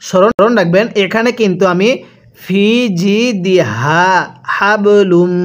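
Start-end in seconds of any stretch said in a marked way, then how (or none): 2.4-5.3 slow জিদিহা with a voiced h, হাবলুম with an airy h; careful Arabic articulation. Shown as a demonstration. Teacher contrasts two h sounds in জিদিহা and হাবলুম, slow careful recitation. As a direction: voice the h in জিদিহা, push air through হাবলুম's h. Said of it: "এই হাটি উচ্চারণের সময় গলার মধ্যে আওয়াজ করছি".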